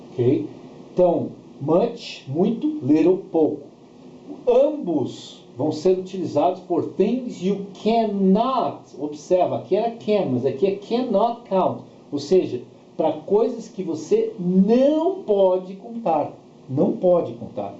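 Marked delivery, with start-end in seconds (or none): none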